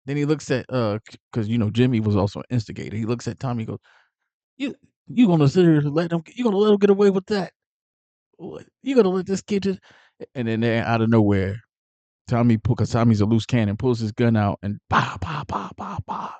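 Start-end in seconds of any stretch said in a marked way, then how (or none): 4.6-9.8 loud, high, hurried egging on; mock goading. Jimmy instigating Tommy against Spider, loud high goading. 14.9-16.3 string of spoken gunshot pops; imitated gunshots. Retelling Tommy pulling his gun on Spider, imitated gunfire.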